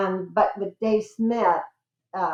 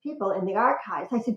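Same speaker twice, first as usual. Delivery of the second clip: normal talking voice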